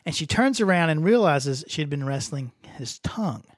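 ordinary delivery

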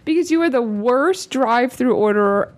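ordinary delivery

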